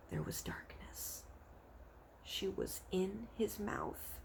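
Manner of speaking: hushed. A narrator's reading. Narrator hushing the moment Sophie is swallowed. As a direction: drop to a whisper for the dark. Suspense in the story.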